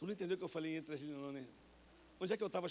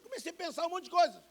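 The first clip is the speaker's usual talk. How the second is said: high, loud preaching cry; impassioned preacher; preaching shout; 13 semitones higher, 11 dB louder than usual. Acted. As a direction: shout it out with fervor. Preacher climax.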